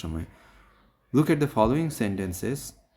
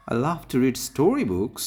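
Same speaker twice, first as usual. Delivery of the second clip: louder, higher lift on "story books"; stress on story books; 4 semitones higher, 5 dB louder, much slower than usual. Shown as a demonstration. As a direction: read slowly, hitting "story books". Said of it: "এভাবে পড়া হয়". ("story books"-এ জোর দিয়ে)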